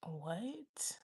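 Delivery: softly drawn-out, rising "what"; baffled what. Retold or imitated. Both reacting to the money, incredulous. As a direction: stretch it upward in disbelief.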